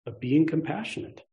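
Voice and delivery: ordinary talk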